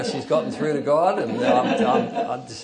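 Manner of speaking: usual speaking voice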